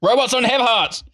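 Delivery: high-pitched comic exclamation; hammed-up high protest; mock outburst. Mock outburst about robot hearts.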